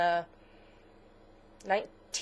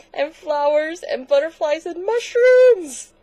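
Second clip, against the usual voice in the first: gushing; 9 semitones higher, 11 dB louder than usual. Real, not acted. Gushing over the frog bag.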